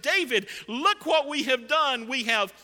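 loud, high-pitched triumphant report; acted-out boast at full voice; proud announcement. Servants proudly present deed to king.

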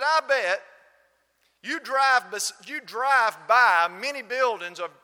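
high, loud and emphatic quote; loud retold challenge. Gentleman betting the Southern visitor has churches nearby, loud retold challenge. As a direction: imitate a man pressing his point.

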